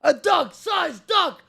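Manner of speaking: high, shouted donation hype. Real, not acted. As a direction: announce it like a hype man. Hyping a donation.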